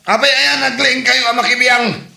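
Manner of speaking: high, loud sneering taunt; mocking. Son jeers at his stepfather in a quarrel. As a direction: jeer at the old man.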